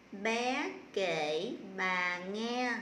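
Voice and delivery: slow, drawn-out words as a reading demo; slow model reading. Shown as a demonstration. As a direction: read the sentence very slowly for pupils. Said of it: "cô sẽ đọc cái câu này". (đọc mẫu cả câu thật chậm)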